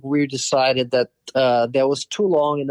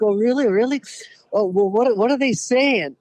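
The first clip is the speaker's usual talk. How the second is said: higher-pitched imitation of an excited wife; 8 semitones higher than usual. Wife asks husband what the boys say about her, imitated as excited. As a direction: act out eager, high-pitched questions. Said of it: "she got so excited".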